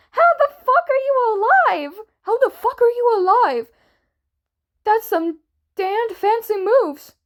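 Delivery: high, loud agitated exclamation. A narrator's reading. Wilbur learns the boys are alive, loudly losing his calm. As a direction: lose the calm, pitch shooting up. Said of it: "Wilbur was less calm".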